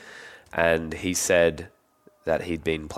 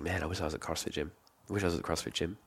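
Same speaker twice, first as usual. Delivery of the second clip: muttered wish; 11 dB quieter, faster than usual. Retold or imitated. Inner thought during football training.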